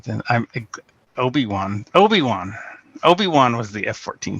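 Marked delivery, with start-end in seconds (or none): none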